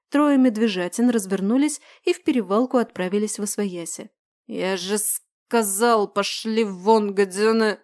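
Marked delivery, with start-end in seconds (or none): 4.5-7.8 put-on slow, slurring drunken voice; slurred drunk. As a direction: drag the words out drunk. Drunk man shouts them off.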